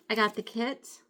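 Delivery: ordinary talk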